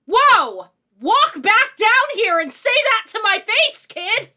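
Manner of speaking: hammed-up parental challenge, each word pushed out; imagined outraged parent. Parents answering a rude teenager, mock outraged scolding. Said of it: "their response would be".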